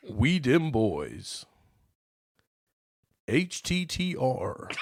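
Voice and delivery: slow, drawn-out announcer reading. Acted. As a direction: announce each name like a stadium announcer. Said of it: "I should do it in an announcer voice".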